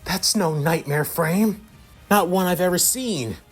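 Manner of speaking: astonished. Acted. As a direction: measure out disbelief. Jeremiah shocked by unit.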